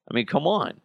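normal talking voice

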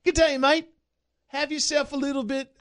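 high, hammed-up Aussie accent; loud, high mock Australian. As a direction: call out like a cartoon Australian.

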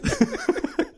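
high-pitched helpless laugh; high laughter. Real, not acted. Comedian cracks up at his own answer, laughing.

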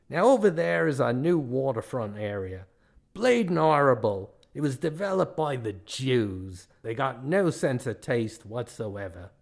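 disdainful put-on Australian accent; mean Australian guide. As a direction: show the sights scornfully, Australian accent. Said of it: "the tale of the meanest man in Australia".

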